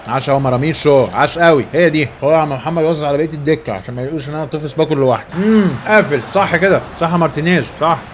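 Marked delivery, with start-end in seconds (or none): none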